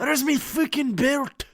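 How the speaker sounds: high, slow, loud leprechaun brogue. Pretend. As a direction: play an indignant cartoon leprechaun. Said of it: "like, a leprechaun kind of thing".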